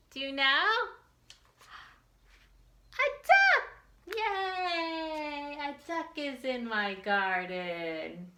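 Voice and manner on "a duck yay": high, loud delighted cry; excited shout. Acted. Teacher revealing the duck to kids, shrieking with delight. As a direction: burst out with joy at the reveal.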